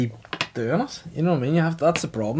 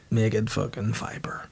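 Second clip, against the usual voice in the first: breathy whispered grumble, slower; whispered jibe; 4 semitones lower, 6 dB quieter, slower than usual. Real, not acted. Complaining about the hotel wifi, whispered under breath. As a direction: hiss the insult under your breath.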